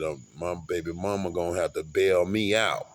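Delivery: ordinary talk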